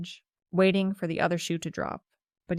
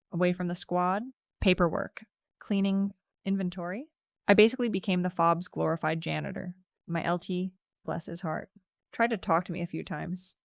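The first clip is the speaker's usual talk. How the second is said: plain reading voice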